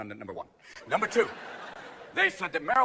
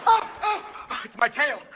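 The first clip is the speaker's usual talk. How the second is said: high, excited dog yelps; startled dog; 9 semitones higher, 5 dB louder, much slower than usual. Acted. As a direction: yelp in sudden dopey surprise. Dog startled by its own tail.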